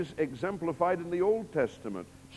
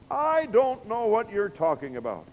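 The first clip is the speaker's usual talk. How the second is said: high, loud flustered denial; 7 semitones higher, 7 dB louder than usual. Acted. Peter denying the servant girl, high and loud. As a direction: brush her off sharply.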